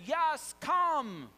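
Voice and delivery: high, loud, welcoming call; acted booming yes; emphatic invitation. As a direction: call them over with gusto. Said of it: "And Jesus says".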